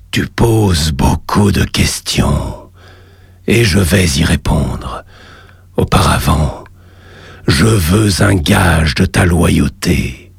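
deep, slow cavernous voice; low commanding god from the lava; underworld god. Acted.

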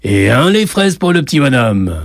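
calling out. A narrator's reading. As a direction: announce the order across a café. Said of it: "dit-il à haute voix".

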